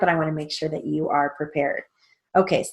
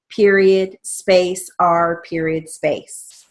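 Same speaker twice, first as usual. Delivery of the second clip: slow "period space R period space"; dictating; 4 dB louder, much slower than usual. Shown as a demonstration. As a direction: spell it out slowly. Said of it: "make sure and have a space".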